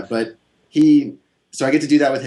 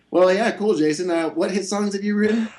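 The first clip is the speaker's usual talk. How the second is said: higher-pitched imitation of a doubter; mock questioner; 9 semitones higher than usual. Imagined listener challenging the songwriter's credentials, mock questioner. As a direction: put on a high voice, rising into the question.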